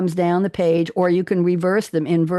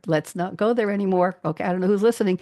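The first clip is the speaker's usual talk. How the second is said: ordinary talk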